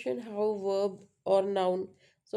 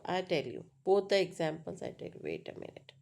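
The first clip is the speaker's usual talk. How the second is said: normal talking voice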